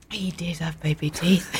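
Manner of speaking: hushed secret whisper. Acted. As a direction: lean in and whisper it. Confiding secret about guest.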